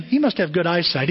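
ordinary talk

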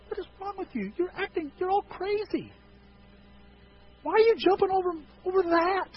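high, whiny teen voice mocking parents; mock kid voice. Kids asking Mom and Dad, in a high mocking whine. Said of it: "has your kids looked at you, Mom, Dad, goes".

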